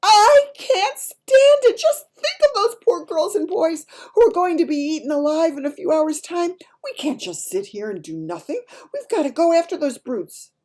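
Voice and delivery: crying out in despair. A narrator's reading. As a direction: cry it out, she can't take it any longer. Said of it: "she stood up and cried out".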